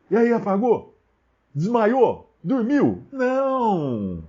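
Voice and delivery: high rhetorical questions, then drawn-out NO; incredulous questioning. Real, not acted. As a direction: pitch up each question, answer with a long falling "Não!". Preacher mocks idea with rhetorical questions. (perguntas retóricas agudas, incrédulas)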